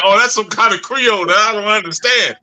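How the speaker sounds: high, teasing put-on innocent voice; mock playing dumb. Teasing a friend who plays dumb about Creole. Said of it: "You, you be like".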